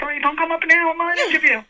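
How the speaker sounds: high-pitched call to husband downstairs; mock warning. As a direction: pitch it way up, shooing him off.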